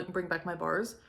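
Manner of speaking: usual speaking voice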